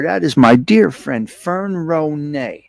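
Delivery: ordinary talk, nothing marked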